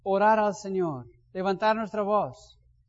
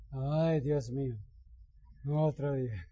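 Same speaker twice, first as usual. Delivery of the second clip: lower, slow, softer sighing complaint; weary lament; 6 semitones lower, 7 dB quieter than usual. Shown as a demonstration. Preacher mocks a tired believer's morning, slow weary sigh. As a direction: sink into it like you can't face the day. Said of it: "Hay gente que dice".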